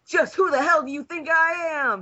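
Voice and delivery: loud, high heroic yell. Acted. Kamina bursting through the wall, yelling his catchphrase. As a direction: belt it like an anime hero's battle cry. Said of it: "he yelled".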